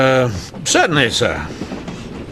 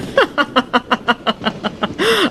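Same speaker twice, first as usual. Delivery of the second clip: light laugh; 11 semitones higher than usual. Acted. Amused at own remark.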